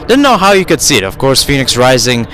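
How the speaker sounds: ordinary talk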